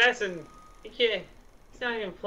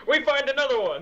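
announcer voice; 11 semitones higher than usual. Pretend. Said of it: "mock outro catchphrase".

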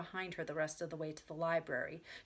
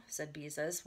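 ordinary delivery, nothing marked

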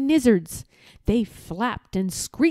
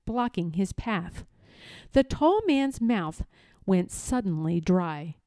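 plain reading voice

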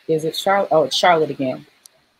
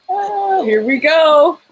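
hyped up; 8 semitones higher, 5 dB louder, much slower than usual. Real, not acted. Hyping the dance.

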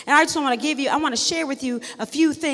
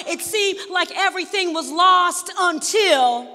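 rising preaching; 3 semitones higher, 3 dB louder than usual. Acted. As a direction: build the congregation toward the turn. Preacher building the story.

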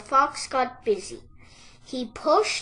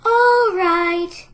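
high, loud drawn-out piglet voice; resigned piglet, pitched high; sighing piglet; 7 semitones higher, 13 dB louder than usual. A narrator's reading. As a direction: give in with a long, high whine. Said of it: "sighed the piglet".

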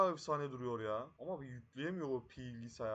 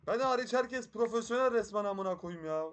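exasperated complaint; 6 semitones higher, 8 dB louder than usual. Real, not acted. Complains after failing in game.

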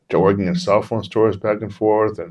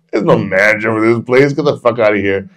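loud, incredulous cop voice; retold scoffing dismissal; 2 semitones higher, 6 dB louder than usual. Police disbelieving the building's new manager, loud scoff. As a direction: brush off the claim forcefully.

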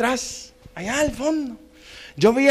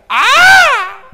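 grandfather's high, loud whoop; imitated excited holler; excited yell; 13 semitones higher, 8 dB louder than usual. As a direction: burst into a long thrilled cry. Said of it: "cuando se emociona grita".

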